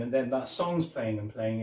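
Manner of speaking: ordinary talk, nothing marked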